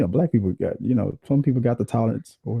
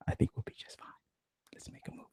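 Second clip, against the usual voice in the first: whisper; 16 dB quieter, faster than usual. Real, not acted. Whispered aside to camera.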